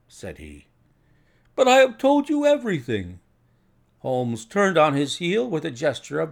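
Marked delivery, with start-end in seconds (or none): 1.5-3.2 high, loud, pleading insistence; anxious protest. A narrator's reading. Patient insisting to the detective he told all, anxious protest. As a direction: plead your honesty, voice pushed right up.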